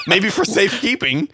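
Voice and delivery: normal talking voice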